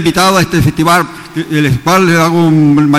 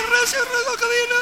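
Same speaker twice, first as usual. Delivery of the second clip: very high and much softer echo; faint echo; 6 dB quieter than usual. Shown as a demonstration. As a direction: answer faintly like a distant echo. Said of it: "El eco de una montaña".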